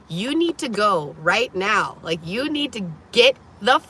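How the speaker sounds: building angry demand; getting mad. Retold or imitated. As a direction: order him out, losing her calm. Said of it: "I'm getting mad at this point".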